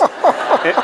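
high burst of laughter; laughing. Real, not acted. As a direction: break into helpless laughter. Reader cracks up at own text.

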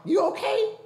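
high startled concern; alarmed. Retold or imitated. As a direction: jump up in alarm at the news. News of brother's accident.

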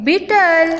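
બીટલ said high, as a model word; English pronunciation demo. Shown as a demonstration. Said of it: "અંગ્રેજી ઉચ્ચાર થશે".

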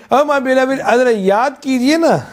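a hint of tender longing; tender plea. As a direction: plead gently, as to a beloved. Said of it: "So Allah is saying".

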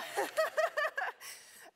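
high, loud burst of real laughter; high-pitched run of embarrassed laughter; emotional laugh. Real, not acted. Laughs off getting emotional in speech.

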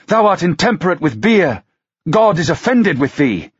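put-on young prophetess scolding John Hardy for his beer; shrill rebuke. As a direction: chastise him, pitch way up. Girl rebukes the drinker.

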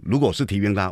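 ordinary talk, nothing marked

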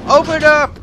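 high, loud playful demand; demanding shout. Pretend. Player yelling at a closed game gate, mock-demanding. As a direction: holler it at a shut door. Calling at a shut game door.

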